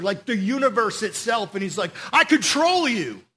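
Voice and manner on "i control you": high, loud declaration of power; forceful, masterful order; commanding shout. Acted. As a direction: hurl it at the storm. Jesus commanding nature in retelling.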